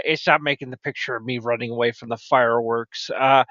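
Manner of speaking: usual speaking voice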